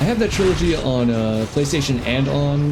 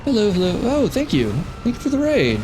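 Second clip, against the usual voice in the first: cute anime streamer; 8 semitones higher than usual. Real, not acted. Greeting raiders in stream voice.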